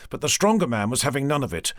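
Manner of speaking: usual speaking voice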